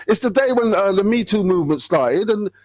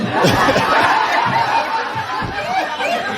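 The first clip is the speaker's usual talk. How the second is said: high breathy laugh. Real, not acted. Laughing at own joke.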